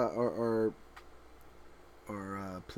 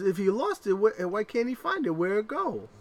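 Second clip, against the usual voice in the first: mock little boy; 9 semitones higher, 4 dB louder than usual. Son asks.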